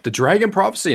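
hammed-up high villager; villager's guess. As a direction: pipe up eagerly from a crowd. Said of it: "the man's like".

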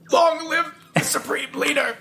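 high, proclaiming state broadcaster voice; north korean newsreader. Retold or imitated. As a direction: announce it like propaganda radio. Quoting the movie scene.

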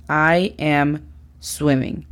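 "I am swimming" slow and falling; slow, settled teacher model; slow confirmed model. Shown as a demonstration. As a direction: state "I am swimming" slowly as the answer. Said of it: "es correcta en inglés".

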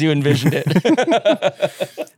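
words slowed by laughter; amused, barely holding the laugh back. Real, not acted. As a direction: laugh all through the sentence. Laughing at quizmaster's mind games.

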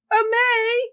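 high, cried-out dismay; horrified cry. Retold or imitated. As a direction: cry out in horror as she walks in. Friend walks into hostage standoff.

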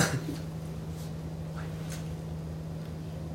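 breathy hushed giggle; whispered laugh. Real, not acted. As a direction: laugh under your breath, no voice. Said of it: "we gotta whisper real quick".

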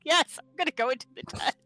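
loud, high, laughing through the words; real giggling apology. Real, not acted. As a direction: crack up at your own silly mistake. Laughing at own mix-up over tents.

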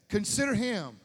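Consider him thrown out loud and strong; loud emphatic. Shown as a demonstration. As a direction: hit "Consider him" with full force. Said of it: "How do you fix that?".

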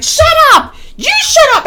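very high re-enacted shrieks; high-pitched shouted comeback; screamed argument. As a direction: scream back at the calm driver. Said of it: "I'm here screaming".

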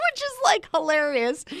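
high, laughing through the words; laughing hard. Real, not acted. Host laughing at the book's silly bargain, high and giggling. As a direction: let the laughter carry the words.

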